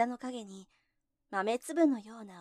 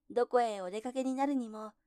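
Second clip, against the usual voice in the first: usual speaking voice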